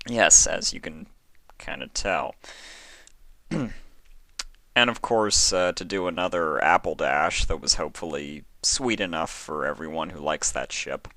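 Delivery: ordinary delivery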